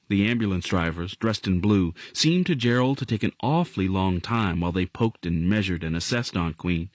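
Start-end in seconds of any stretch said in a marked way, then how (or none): none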